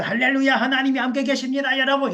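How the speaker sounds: high-pitched mock hallelujah proclamation; exultant testimony. Mimics prosperous believer's testimony.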